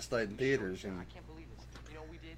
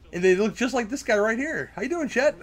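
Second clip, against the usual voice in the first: high, louder mock hello; mock greeting; 10 semitones higher, 6 dB louder than usual. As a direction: call out to him cheerfully. Greeting the guy on screen.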